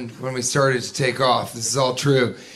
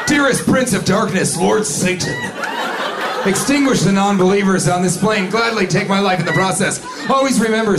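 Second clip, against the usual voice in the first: mock prayer; 5 semitones higher than usual. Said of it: "I just whispered under my breath".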